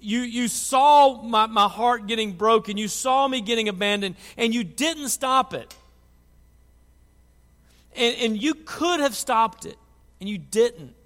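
loud, hurt accusation, slowed on the opening words; retold yelling. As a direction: relive shouting at God over old wounds. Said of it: "I started yelling at him".

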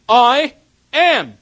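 high, loud declaration of I am; ringing proclamation. Acted. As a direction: call it out to the whole room. Repeats God's name, louder.

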